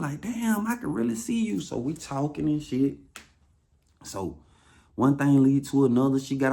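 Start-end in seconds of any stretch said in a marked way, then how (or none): 0.2-1.6 high put-on amazed voice; mock amazed woman. Woman amazed she can see him on video, high voice. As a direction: pitch it way up, wide-eyed.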